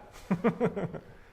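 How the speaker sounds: high bright burst of laughter; delighted laugh. Real, not acted. Teacher laughing with students at a heretical verse: delighted laugh. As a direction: laugh at the audacity of it. Laughs after his exclamation.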